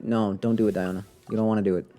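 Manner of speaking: normal talking voice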